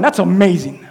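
ordinary talk

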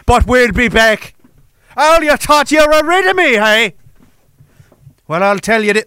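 hammed-up Irish vow to return; Irish accent. Exiled clansman warns the king he'll be back, Irish accent. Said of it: "that was Ireland".

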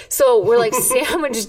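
ordinary delivery

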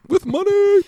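very high, loud silly squeal; mock sponsor announcement. Co-host finishing sponsor plug, hammed-up high shout. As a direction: shriek the end of the plug for laughs.

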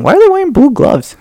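high mock-puzzled squeak. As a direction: squeak the question like a confused kid. Voicing past self puzzled.

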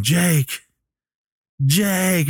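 high, falling, pleading name; retold near-tears call. Billy realizing who left, imitated near-tears call. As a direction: imitate calling out on the verge of crying. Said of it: "he's getting ready to cry".

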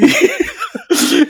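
very high Arrivederci dissolving into laughter; laughing mock goodbye. Retelling Donnie's exit, mock Arrivederci through laughter. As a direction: crack up mid Arrivederci. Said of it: "he's like".

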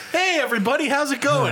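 mock upbeat host intro; bright host greeting. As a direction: greet the followers like a cheery influencer. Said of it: "Whatever, fuck it. Here we go".

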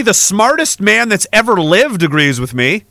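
normal talking voice

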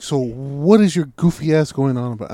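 loud retold scolding; out of patience. Storyteller to the helper who won't lift, fed-up. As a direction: act out fed-up confronting. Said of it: "'cause I have no patience left with him".

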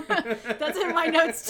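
slow, high words through laughter; laughing. Real, not acted. Delighted her co-host noticed the grill too, laughing.

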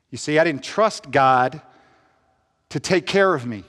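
normal talking voice

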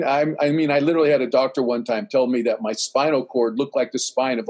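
ordinary talk, nothing marked

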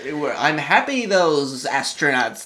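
slow, dry satirical drawl; deadpan mock-cheerful, very slow. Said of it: "he was being very satirical".

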